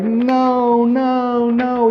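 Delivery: high, strained, broken repeated no's; mock anguished wail; grief-stricken. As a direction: despair breaking through. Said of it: "He's so sad".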